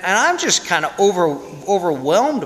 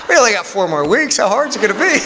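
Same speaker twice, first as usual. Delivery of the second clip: laughing joke; 6 semitones higher, 4 dB louder than usual. Retold or imitated. Joking reply, laughing.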